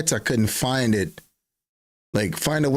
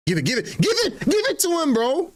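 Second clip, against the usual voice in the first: much higher frantic urging, fast; pleading with the player to pass; yelling at the screen to pass; faster than usual. Real, not acted. Urging a pass during play.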